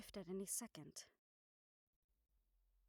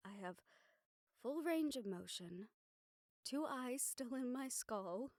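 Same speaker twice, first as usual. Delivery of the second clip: ordinary delivery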